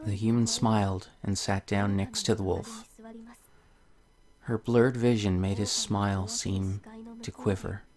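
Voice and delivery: soft, gentle storybook reading; story narration. Acted. Reading a tale to viewers, soft storybook voice. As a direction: read the fairy tale softly to the viewers. Reads the game's story aloud.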